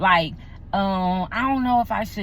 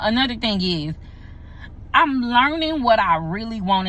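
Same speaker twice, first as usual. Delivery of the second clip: usual speaking voice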